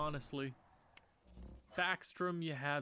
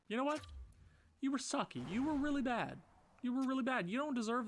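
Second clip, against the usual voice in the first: mock scolding; 9 semitones higher than usual. Real, not acted. Scolding a player, higher voice.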